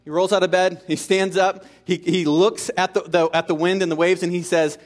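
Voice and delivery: normal talking voice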